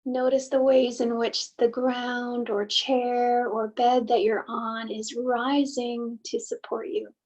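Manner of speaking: unhurried meditation guide, long pauses between phrases; guided meditation. Acted. Host leading listeners to soften and settle, guided meditation. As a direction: gently guide listeners inward, leaving space after each thought.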